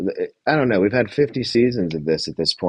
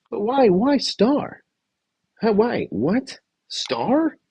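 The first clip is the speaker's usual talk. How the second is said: high-pitched incredulous questions; slow, pleading bafflement, far above usual pitch; baffled disbelief; much slower than usual. Acted. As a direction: protest in a squeaky-high, bewildered voice. Baffled by the vote choice.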